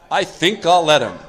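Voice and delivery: mock the ego, laughing through "let him"; smug punchline. Alcoholic graciously allowing God to help, smug and laughing. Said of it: "Third step".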